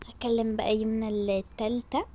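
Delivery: hushed, breathy scheming aside; whispered aside. Acted. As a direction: whisper it so no one hears the plan. Aside whispered between phone calls.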